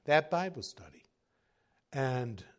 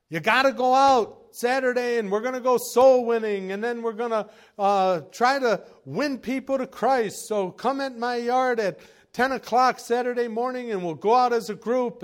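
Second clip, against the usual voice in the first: loud, much higher exhortation; ranting and raving; 9 semitones higher, 8 dB louder than usual. Retold or imitated. Preacher recalling ranting at church members, shouting. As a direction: belt it out like a fired-up tirade. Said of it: "me ranting and raving".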